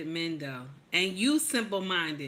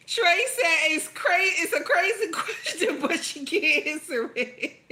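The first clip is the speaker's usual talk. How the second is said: high-pitched, giggly read-aloud; laughing while reading; 7 semitones higher than usual. Real, not acted. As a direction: read the comment through bursts of laughter. Still cracking up reading chat comments.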